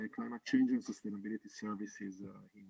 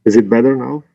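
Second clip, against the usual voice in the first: much louder, pushing the voice; 3 semitones higher, 19 dB louder, faster than usual. Real, not acted. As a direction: raise the voice to be heard. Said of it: "we cannot hear you".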